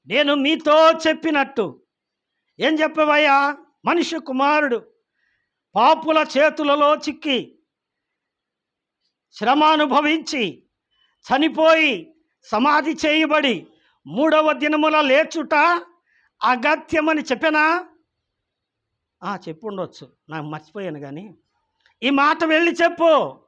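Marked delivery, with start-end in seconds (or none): 0.1-17.9 higher and louder, pressing each phrase home; a preacher driving the prophecy hard; emphatic proclaiming. Acted. Jesus firmly reminding Mary of his promise, proclaimed loud and high. 22.0-23.4 higher and louder, pressing each phrase home; a preacher driving the prophecy hard; emphatic proclaiming. Acted. Jesus firmly reminding Mary of his promise, proclaimed loud and high.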